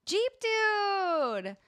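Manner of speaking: Jeep Dude called out high and loud; excited announcement. Real, not acted. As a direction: proclaim the winner like a game show host. Announcing the giveaway winner.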